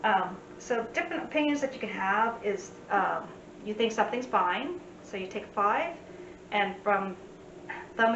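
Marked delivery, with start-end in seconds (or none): none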